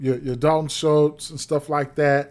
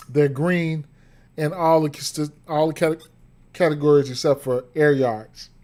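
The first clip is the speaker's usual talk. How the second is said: ordinary delivery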